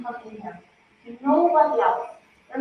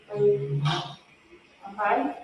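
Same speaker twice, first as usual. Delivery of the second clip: ordinary talk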